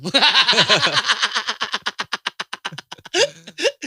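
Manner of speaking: long high laugh; loud burst of laughter. Real, not acted. Laughing at his own joke.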